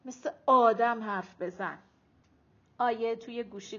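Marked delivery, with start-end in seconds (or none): 0.0-1.8 mild grumbled rebuke; grumbling. A narrator's reading. Arezu telling Ayeh to talk properly, grumbling. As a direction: nag with a loud stressed start. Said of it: "آرزو غر زد".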